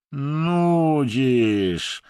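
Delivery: high, loud and weepy; bored Russian whining, loud and high; tearful whine. A narrator's reading. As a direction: whine it like he's close to tears. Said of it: "stwierdził Rosjanin płaczliwym głosem".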